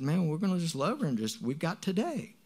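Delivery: high, earnest appeal; impassioned insistence, retold. Urging family to just love her today, impassioned. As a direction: rise in pitch to insist.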